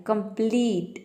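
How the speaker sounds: कंप्लीट drawn out slowly on plee; stress on the ee. Shown as a demonstration. Teacher shows students how to say it, कंप्लीट with stretched ee. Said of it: "यहाँ पे ई पे थोड़ा जोर देना है".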